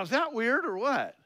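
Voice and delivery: usual speaking voice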